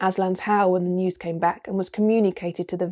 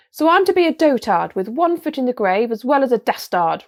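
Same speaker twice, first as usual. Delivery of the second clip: loud, higher-pitched indignant roar; outraged, raised voice; angry roar; 5 semitones higher, 6 dB louder than usual. A narrator's reading. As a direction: roar it like an insulted king. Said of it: "roared Miraz".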